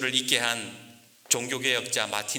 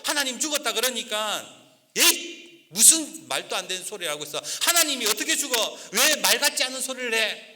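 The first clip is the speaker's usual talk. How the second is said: high, irritated outburst; irritated Luther; 7 semitones higher than usual. Acted. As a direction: scoff at his wife, annoyed. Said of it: "신경질이 확 나는 거예요". (아내에게 신경질 내는 루터, 높은 목소리로)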